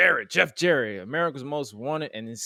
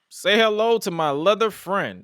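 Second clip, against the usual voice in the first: lightly mimicked movie-quote delivery, raised and slower; louder, drawn-out take on a famous line; Scarface quote; 7 semitones higher, 7 dB louder than usual. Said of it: "trying to quote Scarface".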